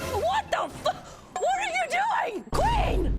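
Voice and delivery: shrieky high-pitched scolding; high, exasperated squeal; high-pitched outcry. Real, not acted. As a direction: cry out in a very high voice. Mock outrage at game character.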